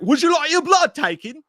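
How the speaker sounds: high, loud, hammed-up London accent; put-on London squawk for a hospital worker; terrible London accent. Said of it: "That's the worst fucking London accent".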